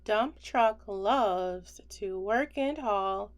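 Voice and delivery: usual speaking voice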